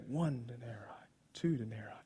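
unvoiced soft counting; whispered counting. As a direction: whisper each coin as you count. [name] recounting pennies.

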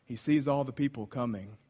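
normal talking voice

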